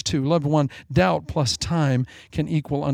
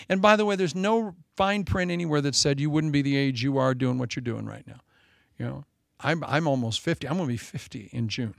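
ordinary delivery

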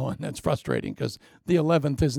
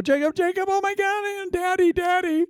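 much higher, whiny frantic calling; panicked sons. Acted. Sons running home to their father, high whiny panic. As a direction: whimper for Daddy like scared kids. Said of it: "and they go".

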